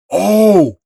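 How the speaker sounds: loud, high burst of hype; excited shout. Real, not acted. Reacting to the manga reveal, hyped shout. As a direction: yell out at the reveal.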